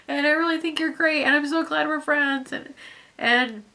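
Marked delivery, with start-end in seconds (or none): 0.1-2.5 higher, eager, overly earnest; awkward gushing friend. Retold or imitated. Imitating a weird person thanking a new Minecraft friend, higher and eager. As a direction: play the weird friend who overshares. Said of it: "that weird person that's like".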